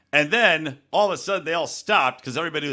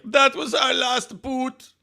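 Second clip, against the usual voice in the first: high, slow, put-on German accent; mock German lament; 11 semitones higher, much slower than usual. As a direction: wail it like a heartbroken German barman, slowly. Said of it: "some German guy in the back crying".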